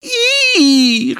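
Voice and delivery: high squealing "ih" of disgust; high girlish yelp of disgust at rain. A narrator's reading. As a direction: shriek a little "ih" at the raindrops. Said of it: "rief Zilli".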